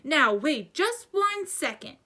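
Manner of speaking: indignant roar, drawn out; thundering. A narrator's reading. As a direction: stretch out each word in loud anger. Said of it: "Hagrid thundered".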